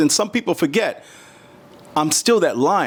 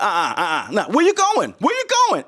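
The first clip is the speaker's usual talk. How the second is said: much higher taunting call after him; challenging; 5 semitones higher than usual. Retold or imitated. Shouting after the man who walked away, much higher and challenging. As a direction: call him back, daring him to leave.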